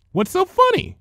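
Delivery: high, loud demanding question. A narrator's reading. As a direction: demand an answer, offended. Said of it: "she demanded".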